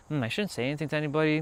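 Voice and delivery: soft, quick, hesitant mumble; mock his old fearful self, hushed; timid inner voice. Streamer imitating his former shy self, quick and soft. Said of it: "I used to have this fear".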